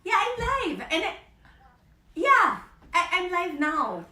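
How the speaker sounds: high, loud exasperated yell; shouting to sister. Real, not acted. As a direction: yell over to her in the next room. Shouting back to sister off-camera.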